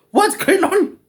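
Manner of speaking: high slow comic voice asking what's happening; mock squeaky voice. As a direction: play a startled little character, drawn out. Sudden put-on voice after cough.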